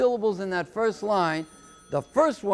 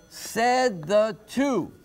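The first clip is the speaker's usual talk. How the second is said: "said the two" slowly, landing heavy on "two"; stress on TWO; 2 semitones higher, much slower than usual. Shown as a demonstration. Teacher showing the heavy beat, slow "said the two". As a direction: scan "said the two" slowly with the weight on "two". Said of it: "Two is heavy".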